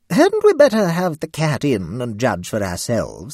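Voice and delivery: a grande dame proposing, drawn out; hostess suggesting. Acted. Lady Blemley urging her guests, slow and lofty. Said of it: "suggested Lady Blemley".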